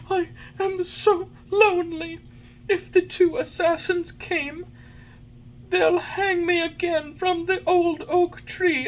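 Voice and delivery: high, wailing puppet sobs; sobbing. A narrator's reading. Pinocchio mourns the dead fairy and his lost father, sobbing. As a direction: wail it in a very high voice, drawing words out. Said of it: "As he sobbed".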